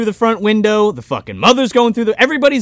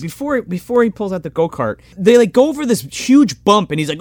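ordinary talk

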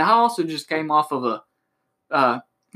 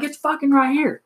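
furious yell; 12 semitones higher than usual. Retold or imitated. Salesman pointing at the deposit slip, furious yell.

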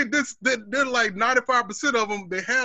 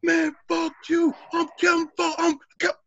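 fake tough guy swagger; 9 semitones higher than usual. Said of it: "The dude was like".

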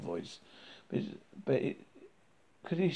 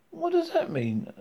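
high-pitched cry of confusion; mild, high exclaimed question. A narrator's reading. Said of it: "cried Arthur".

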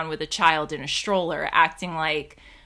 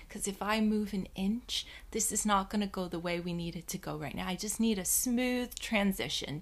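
much softer, murmured thoughts; hushed inner voice; 9 dB quieter than usual. Retold or imitated.

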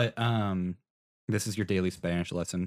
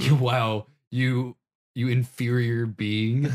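slow, loud mock scorn; drawn-out playful insult; mock disdain; 2 semitones higher, 6 dB louder, much slower than usual. Mock insult over R's.

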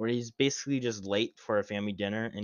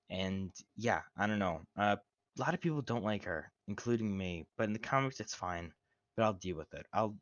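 ordinary talk, nothing marked

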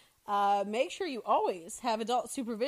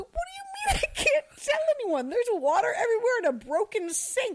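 high, laughing, outraged disbelief; incredulous shout; 9 semitones higher than usual. Retold or imitated. Teacher answering the girl who broke the sink, laughing shout. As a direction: yell it half laughing in disbelief. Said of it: "I'm like".

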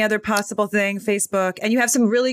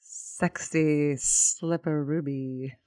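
slow sultry drawl; 4 semitones lower, 7 dB quieter, much slower than usual. Acted. Cohost drawls a nickname.

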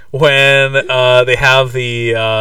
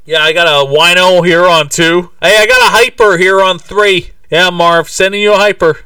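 loud, higher put-on dispatcher voice; police dispatcher; 5 semitones higher, 6 dB louder than usual. Imitating cops passing on the call, loud dispatcher. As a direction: call it out like a desk cop.

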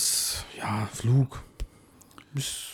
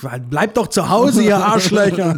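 loud ranting outburst, half laughing; Klaus Kinski rant; 6 semitones higher, 7 dB louder, slower than usual. Pretend. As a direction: rage at everyone, Kinski style. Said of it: "dann werden wir hier zum Klaus Kinski". (wütender Kinski-Ausraster)

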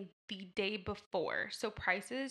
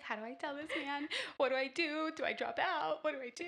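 higher, mock panicky questions; panicked friend; 5 semitones higher than usual. Imitating her panicking friend on the call, higher pitched. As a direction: play the frantic friend asking what to do. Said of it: "She's like".

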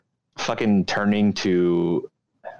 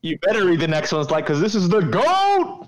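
excited; 9 semitones higher, 3 dB louder than usual. Real, not acted. Hyping the next girl.

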